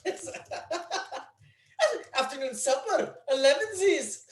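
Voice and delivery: high-pitched, hammed-up Scottish accent; mock Scottish accent. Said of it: "It's a Scottish accent".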